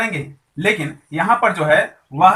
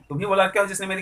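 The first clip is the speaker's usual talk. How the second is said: ordinary talk, nothing marked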